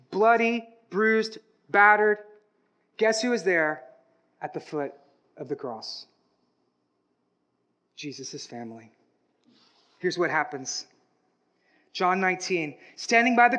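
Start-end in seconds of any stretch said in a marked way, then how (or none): 4.4-8.9 much softer, lower, grave hush; hushed sombre. Acted. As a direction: let the voice sink to a near hush. Preacher drops voice at crucifixion.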